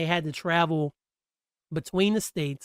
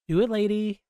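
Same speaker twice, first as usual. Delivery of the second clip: imitating Chit; 5 semitones higher than usual. Chit's birthday card message.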